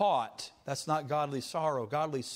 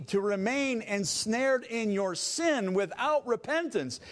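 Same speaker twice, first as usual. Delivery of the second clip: rising fiery sermon, higher and louder; preaching at full force; 9 semitones higher, 5 dB louder than usual. Acted. Pastor warns the saints about a church that won't discipline, preaching at full force. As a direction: drive it home like a pulpit climax.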